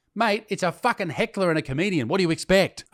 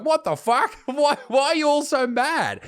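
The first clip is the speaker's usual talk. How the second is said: higher, incredulous what-the-fuck, a laugh breaking through; baffled outrage; 11 semitones higher, 3 dB louder than usual. Retold or imitated. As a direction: act stunned at the pile-on. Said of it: "here I'm just like".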